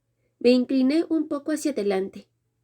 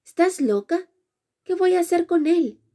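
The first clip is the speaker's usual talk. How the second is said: higher-pitched incredulous retort; scornful retort; 6 semitones higher than usual. A narrator's reading. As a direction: scoff at the very idea, pitched up. Scornful retort to friend's question.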